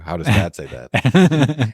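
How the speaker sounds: mock puzzled. Father feigning not to understand.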